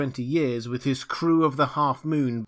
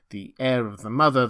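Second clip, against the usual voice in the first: usual speaking voice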